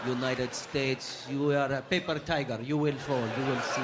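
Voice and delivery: put-on Arabic-accented English cursing. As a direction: grumble threats in a heavy Arabic accent. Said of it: "Arabs love to cuss in English".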